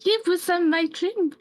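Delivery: high frightened insistence; frightened Gerta clinging to her nightmare. Acted. Terrified after nightmare.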